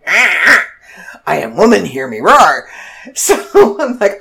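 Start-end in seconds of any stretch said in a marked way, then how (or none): 0.1-2.7 louder, playful proud declaration; mock primal roar. She tells viewers she felt primal after the burger, mock roaring. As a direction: roar it like a hammed-up warrior woman. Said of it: "I felt primal".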